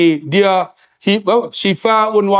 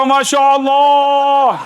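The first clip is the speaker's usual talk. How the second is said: drawn-out awe; 5 semitones higher, much slower than usual. Retold or imitated. Policeman in awe.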